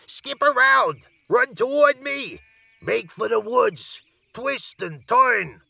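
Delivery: very high, slow goose commands; bossy goose. A narrator's reading. Goose directing Wilbur's escape, very high and commanding. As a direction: honk out orders in a rush of urgency. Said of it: "cried the goose".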